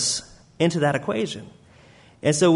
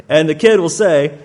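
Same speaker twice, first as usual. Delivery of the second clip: loud amused aside; 9 dB louder than usual. Real, not acted. Laughing narration, much louder.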